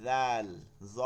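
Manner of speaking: জাল and জ said softly; soft articulation. Shown as a demonstration. Teacher showing students the soft letters, জাল and জ softly articulated. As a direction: separate letters with a soft z sound. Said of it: "আবার কোনোটা নরম".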